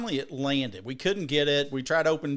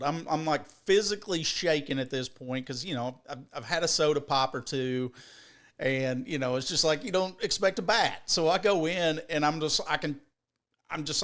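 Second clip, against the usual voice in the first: usual speaking voice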